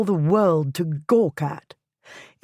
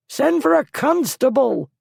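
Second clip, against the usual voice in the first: much higher, loud frantic scream; panicked scream; 9 semitones higher, 4 dB louder than usual. A narrator's reading. Lord in his carriage screaming at his servants, panicked scream. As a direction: scream for help as the mob closes in. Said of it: "he screamed".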